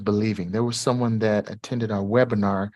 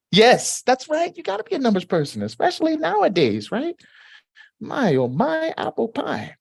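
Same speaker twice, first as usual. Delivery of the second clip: excited; 14 semitones higher, 3 dB louder than usual. Real, not acted. Cheering, singsong rhyme.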